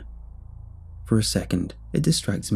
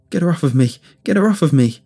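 loud panicked higher shout; 6 semitones higher, 6 dB louder, faster than usual. A narrator's reading. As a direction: scream for help in panic. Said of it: "I shouted".

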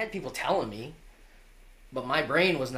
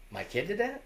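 high-pitched incredulous question; retold disbelief; 9 semitones higher than usual. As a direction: jump up in pitch, as a parent in disbelief. Retold shocked reply to school call.

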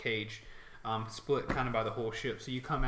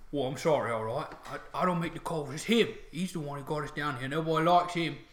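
upset orc; 2 semitones higher than usual. Acted. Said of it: "they're very upset".

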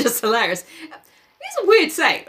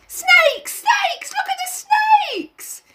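much higher scream of Snakes; high terrified child shouting; screaming little boy; slower than usual. Acted. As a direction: shriek the warning. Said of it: "The little boy screamed at them".

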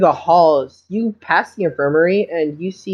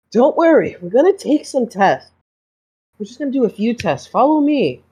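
doctor voice; 7 semitones higher than usual. Acted. GM voices doctor reassuring girl.

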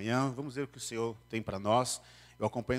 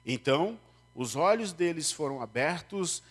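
higher, solemn Bible reading; scripture reading; 6 semitones higher, 3 dB louder than usual. Acted. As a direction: read the passage to the congregation. (leitura bíblica solene)

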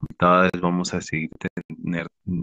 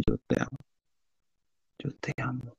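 very soft, slightly higher, tender; inner voice, hushed; 6 semitones higher, 10 dB quieter, faster than usual. Retold or imitated. Recalling the loving voice inside him, hushed. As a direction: fade almost to nothing. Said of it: "Así dentro de mí".

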